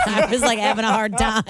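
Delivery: giggling through the words; words bubbling with laughter; laughing. Real, not acted. Laughs mid-sentence.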